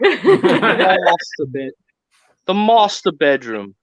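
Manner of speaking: "master bedroom" slowed, the A stretched posh, breaking into a laugh. Retold or imitated. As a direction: drag out "master" grandly, laughing through it. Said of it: "he exaggerates it".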